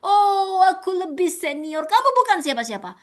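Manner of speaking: mock arrogant senior voice. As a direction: talk down to a junior. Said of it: "Bukan mengintimidasi".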